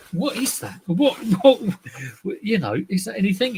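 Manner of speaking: high, baffled questioning of himself; wondering aloud at a strange find; puzzled what is that. Retold or imitated. Collector puzzling over a badge, baffled.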